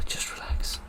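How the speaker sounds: breathy whisper, talking himself down; hushed self-calming under pressure. Real, not acted. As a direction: whisper it to steady your nerves. Tense moment, calming himself.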